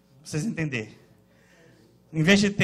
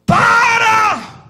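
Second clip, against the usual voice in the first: loud high bark of Para!; sudden pulpit shout; shouted stop; 12 semitones higher, 9 dB louder than usual. Acted. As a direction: cut them off with a shout. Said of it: "preacher shouts stop".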